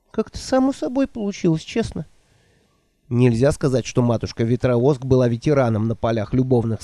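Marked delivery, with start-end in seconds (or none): 0.1-2.0 put-on higher, fluttery old woman voice. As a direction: play the flustered old witch. Nanny voiced by narrator.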